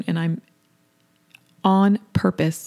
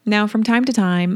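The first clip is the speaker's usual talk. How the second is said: normal talking voice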